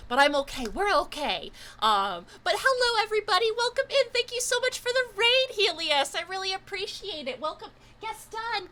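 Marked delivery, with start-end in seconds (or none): 2.4-7.4 high, fast, bright thanks for the raid; excited welcome. Real, not acted. Streamer gushing thanks to Helios for the raid. 7.4-8.7 pet voice. Real, not acted. Streamer cooing for Gaston to come. Calling cat for treat.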